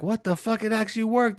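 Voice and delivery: high-pitched amazement; astonished disbelief. Retold or imitated. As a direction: act out stunned disbelief up high. Retold shock at wish coming true.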